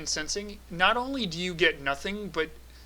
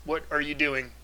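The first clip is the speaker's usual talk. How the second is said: android voice. Acted. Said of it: "in a very android voice".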